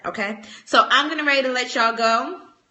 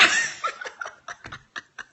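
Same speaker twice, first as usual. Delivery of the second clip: breathy laugh; 8 dB quieter than usual. Real, not acted. Soft laugh.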